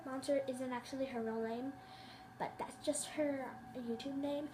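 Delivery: soft secret whisper; whispered aside. Real, not acted. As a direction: slip it in as a whisper. Aside about friend's name.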